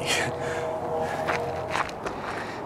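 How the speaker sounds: quiet breathy chuckle under the breath; a hushed little laugh at the joke; soft laugh. Real, not acted. Laughing at own rhyme joke.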